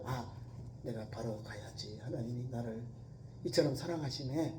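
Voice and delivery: soft repentant murmur. Acted. Believer's repentant inner words.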